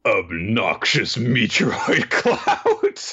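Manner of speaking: villain voice. Retold or imitated. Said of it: "he's like".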